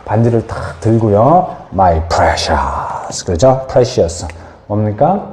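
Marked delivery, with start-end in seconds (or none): 1.7-3.2 low, drawn-out creepy whisper of Gollum. Retold or imitated. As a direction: croon it like Gollum clutching the ring. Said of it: "골룸이 뭐라 그래요".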